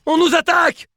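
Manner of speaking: alarm shout. Acted. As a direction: sound the alarm loudly. Alarm call in battle game.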